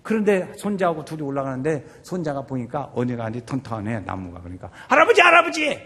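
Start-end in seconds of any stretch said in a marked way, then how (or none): 4.9-5.8 high, loud acted child's call; grandson calling out. Grandson spots a tree and calls his grandfather, high loud call.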